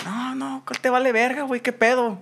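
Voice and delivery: high snapping comeback; mock defiant retort. The aggressor talking back, high and defiant.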